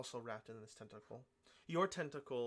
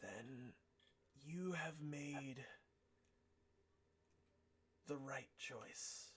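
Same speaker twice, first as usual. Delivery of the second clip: raspy voice; 4 dB quieter, much slower than usual. Acted. Entity answers the hero.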